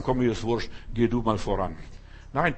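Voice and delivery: ordinary talk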